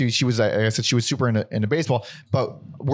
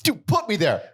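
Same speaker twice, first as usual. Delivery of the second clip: pleading; 10 semitones higher, slower than usual. Retold or imitated. Retold pleading, rising.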